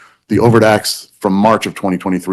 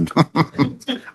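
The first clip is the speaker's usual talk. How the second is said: a quick amused laugh; short real laugh; 7 semitones higher, 7 dB quieter than usual. Real, not acted. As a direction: laugh it off lightly. Judge laughs at own quip.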